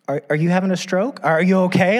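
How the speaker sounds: wife's worried check. Retold or imitated. Wife asking husband if he's okay, mock alarm. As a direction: playfully imitate the wife's alarmed question. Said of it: "so she'll be like".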